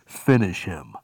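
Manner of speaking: deep booming announcer call of finish him; game announcer. Retold or imitated. Preacher imitating the game telling the player, deep and booming. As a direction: boom it out like a fighting game announcer. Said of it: "and it would say".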